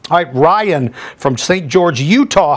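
ordinary talk

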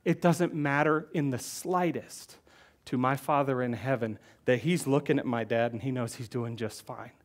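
usual speaking voice

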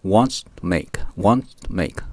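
"wants to make" with "to" nearly dropped, whispered and slow at first; practice drill. Shown as a demonstration. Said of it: "そういう発音の仕方を練習してください". (「wants to make」をtoを言わずに練習)

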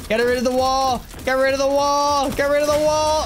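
will the wall away; urgent pleading. Real, not acted.

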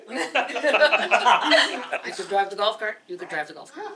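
slow, laughing words; laughing quote. Retold or imitated. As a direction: giggle through the quote. Laughing while quoting Anton.

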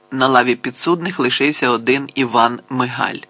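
ordinary delivery

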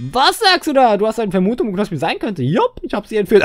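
high, loud mock outburst; hammed-up excitement. Player voicing a reply to a guess, hammed-up excitement. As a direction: overplay it with a jump up in pitch.

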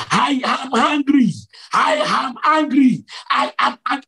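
high, insistent repeated declaration; angry divine voice. Retold or imitated. Preacher relays Jesus's words that echoed through him, high retold anger. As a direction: pronounce the anger high and forcefully. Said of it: "angrily saying that".

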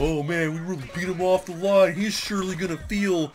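lightly voiced gloating teens, slowing down; a hint of smug taunting teen; mocking kids' voice. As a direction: mimic the smug kids who think they won. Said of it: "you know".

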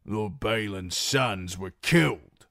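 slow, doubting retort; mild suspicion. Acted. Guard doubts Reek's claim, slowly and suspicious.

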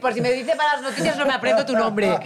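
high, laughing put-on voice of the oncologist; laughing mock quote. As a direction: giggle through the teasing line. (imitando a la oncóloga entre risas, en tono agudo)

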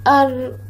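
أر closed on a rolled r with no vowel after; vowelless first r. Shown as a demonstration. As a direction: stop أر on the trilled r. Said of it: "المرة الأولى ساكنة".

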